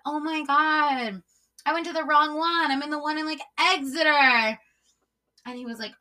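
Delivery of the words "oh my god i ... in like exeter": feigned dismay. Retold or imitated. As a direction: play shocked at your own mistake. Acting out her fake mix-up.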